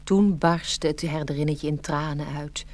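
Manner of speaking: ordinary delivery, nothing marked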